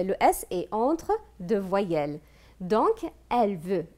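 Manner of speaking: normal talking voice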